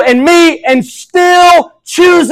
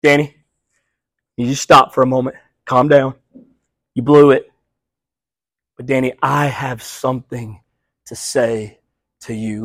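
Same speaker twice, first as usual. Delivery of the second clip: much softer, fading to a hush, calming a friend who blew it; gentle soothing Jesus; 13 semitones lower, 8 dB quieter than usual. Retold or imitated. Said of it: "Jesus goes".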